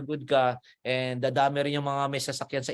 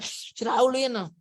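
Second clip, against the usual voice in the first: mock angry viewer; 8 semitones higher, slower than usual. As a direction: act the viewer seething at the villain. (galit na manonood sa kontrabida)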